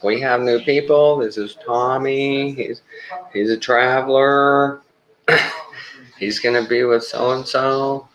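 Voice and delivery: high, drawn-out singsong announcement; imitating staff introduction. Staff introducing the new traveler to the team, high drawn-out mimicry.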